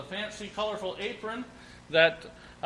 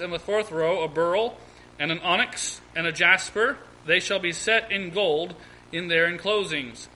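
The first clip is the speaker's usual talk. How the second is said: measured, projected Bible reading; formal scripture read aloud; 3 semitones higher, 4 dB louder than usual. Real, not acted. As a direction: read the verse out to a congregation in deliberate phrases. Reads scripture aloud to congregation.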